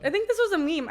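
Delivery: excited, high and loud clarification. Real, not acted. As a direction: repeat it loudly for someone who missed it. Repeats after "This is a what?.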